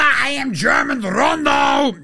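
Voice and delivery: high, slow, shouted boast; hammed-up slow shouted proclamation; mock Schroeder boast. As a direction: bellow it like a proud German hero. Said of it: "Dennis Schroeder".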